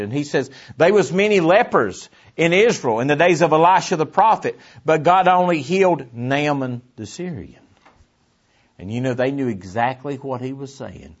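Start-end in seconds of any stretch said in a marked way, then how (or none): 0.8-7.6 raised pulpit voice, high and loud; forceful preaching. Real, not acted. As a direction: proclaim it to the congregation.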